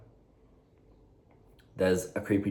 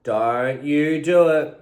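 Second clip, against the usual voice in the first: drawn-out, louder scolding; slow stern warning; 8 semitones higher, 10 dB louder, slower than usual. Real, not acted. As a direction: spell it out, slow and firm. Scolding the dog, slower.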